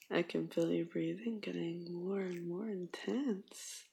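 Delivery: normal talking voice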